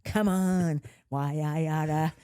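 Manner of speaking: lightly voiced old-movie tough kid; Annie-style scrapper. Kid pushing up sleeves to fight, mock tough. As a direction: put up your dukes, why I oughta. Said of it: "I was like this".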